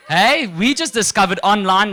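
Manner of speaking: ordinary talk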